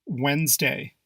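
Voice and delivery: Wednesday said WENZ-day; two syllables, Wednesday as WENZ-day; silent first D. Shown as a demonstration. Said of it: "Proper pronunciation is Wednesday".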